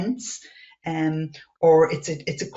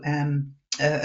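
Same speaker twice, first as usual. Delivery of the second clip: ordinary talk, nothing marked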